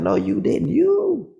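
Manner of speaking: high squealing You; mocking the angry man; sassy taunt. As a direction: screech it sassily.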